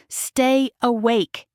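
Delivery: slow strained groan. A narrator's reading. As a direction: push the words out through pain. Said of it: "he groans".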